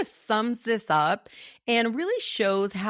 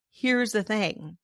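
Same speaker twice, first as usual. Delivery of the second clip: ordinary talk, nothing marked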